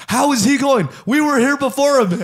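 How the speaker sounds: high, loud, indignant complaint; pissed off. Retold or imitated. Waiting customers object to him going first, pissed off. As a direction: imitate outraged customers protesting. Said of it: "they were so fucking pissed".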